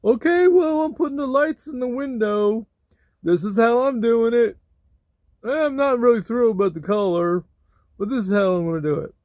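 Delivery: high, loud put-on voice; imitating most people. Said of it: "Most people would just be like".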